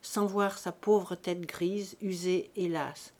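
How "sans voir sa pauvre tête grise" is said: plain reading voice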